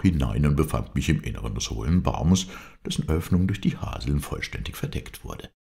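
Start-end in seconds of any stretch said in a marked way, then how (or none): none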